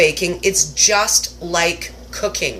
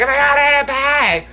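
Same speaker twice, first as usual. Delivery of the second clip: mock excitement; 7 semitones higher than usual. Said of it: "And I was like".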